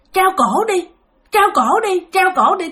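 high, insistent chant urging someone on; taunting spirits. Retold or imitated. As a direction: imitate ghostly voices egging a victim on. Spirits urging grandfather to hang.

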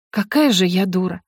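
ordinary delivery, nothing marked

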